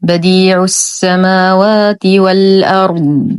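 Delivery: slow, drawn-out tajweed recitation; Quran recitation. Shown as a demonstration. Teacher recites the whole verse for learners, slow measured recitation. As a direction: chant the verse with long held vowels and hums.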